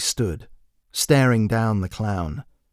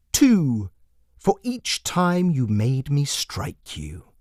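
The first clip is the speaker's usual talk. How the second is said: high, slow, sing-song jester voice with a cold edge; a creepy clown savouring each word; menacing jester; 4 semitones higher, slower than usual. Acted.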